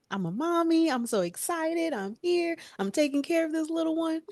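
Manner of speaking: high, eager and upbeat; excited new mom. Retold or imitated. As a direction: recreate a first-time mother's eager enthusiasm. Acting out her eager first-time-mom self.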